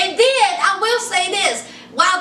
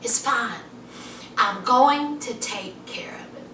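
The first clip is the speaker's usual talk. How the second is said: breathy whispered reassurance; soft, hushed and secretive; 5 semitones lower, 7 dB quieter than usual. Retold or imitated. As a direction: whisper it to settle her down. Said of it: "He was like".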